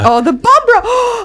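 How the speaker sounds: high, slow, delighted "Oh, the Bumbra". Real, not acted. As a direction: savour the new nickname with real delight. Delighted at a new nickname.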